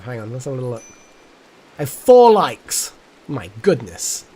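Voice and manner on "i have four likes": loud, high, slow disbelief; hammed-up astonishment; mock shock. Mock outrage at few likes.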